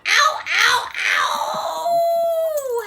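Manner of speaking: very high, loud yelps; howling in pain. A narrator's reading. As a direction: howl with hurt. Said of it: "howled the wolf".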